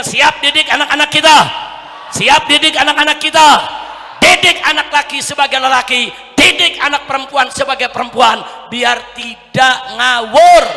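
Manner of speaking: very loud rallying shout; full-force preaching. Real, not acted. Preacher calling parents to pledge, loud rousing shout. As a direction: whip the crowd up to a climax.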